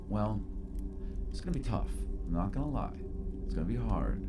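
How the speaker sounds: sinking into a breathy whisper; spirit in the mirror. Acted. Des in the mirror whispering encouragement to the girl. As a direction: promise it in a secret whisper. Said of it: "she looks at you and she's like".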